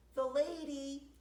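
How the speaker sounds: loud plaintive call. Acted. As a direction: call out into the rain. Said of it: "he called".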